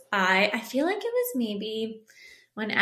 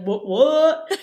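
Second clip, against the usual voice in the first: high, loud rowdy party-bro yell; whooping like a frat guy at a party; frat boy voice; 6 semitones higher, 8 dB louder than usual. Retold or imitated. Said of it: "Everyone needs to know the frat boy voice".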